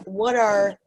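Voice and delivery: ordinary delivery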